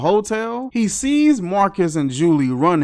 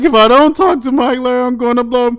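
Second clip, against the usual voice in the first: higher, louder, fast strained plea; mock desperate Julie; 7 semitones higher, 7 dB louder, faster than usual. Imitating Julie strained as if holding a fart. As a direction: push it out like you're about to burst. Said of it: "She like".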